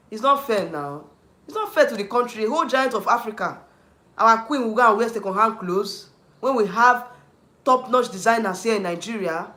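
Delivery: loud, heated outburst; indignant loud complaint; angry rant. Real, not acted. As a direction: rant at the idea, raising the voice. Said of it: "I can only rant".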